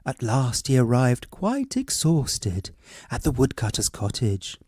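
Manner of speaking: ordinary delivery, nothing marked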